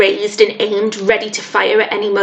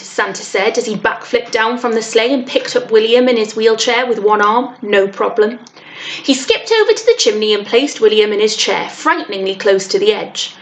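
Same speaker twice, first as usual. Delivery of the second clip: usual speaking voice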